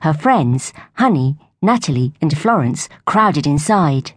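plain reading voice

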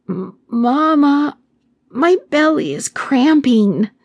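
put-on high, slow, faltering little girl; weak child voice. A narrator's reading. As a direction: stammer it out like a sick little girl. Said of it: "in a weak voice".

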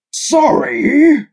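outraged shriek jumping far up, loud. A narrator's reading. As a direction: voice leaps a register in disbelief. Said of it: "shrieked the judge".

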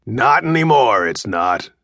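lightly voiced offhand stranger; dismissive intruder. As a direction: brush the kid off. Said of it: "he said".

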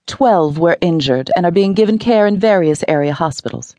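a hint of a TV news reader; lightly voiced newscaster, grave. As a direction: read it like the evening news. Newscaster reads report.

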